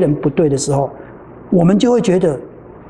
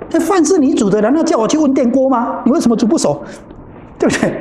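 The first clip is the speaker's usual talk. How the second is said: higher, exasperated questioning; mild retold scolding; 7 semitones higher than usual. Teacher scolds the cook over raw rice, exasperated. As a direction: replay your irritation, pitched up.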